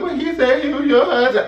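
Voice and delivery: very high-pitched mocking imitation; mimicked desk lady. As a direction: squeak it out as the clueless clerk. Imitates hotel desk lady answering back.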